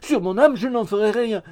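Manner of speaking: loud, high vow; lively. A narrator's reading. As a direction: swear eagerly and emphatically. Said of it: "avec une remarquable vivacité". (serment vif, fort et aigu)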